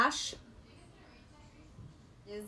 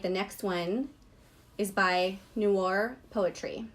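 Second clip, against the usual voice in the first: usual speaking voice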